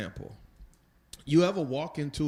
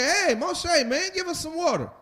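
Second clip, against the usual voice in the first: much higher, pushy and casual; brash crowd calling Moshe for water; demanding people; 14 semitones higher, 4 dB louder than usual. Retold or imitated. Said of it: "This time they say".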